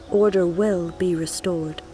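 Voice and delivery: ordinary delivery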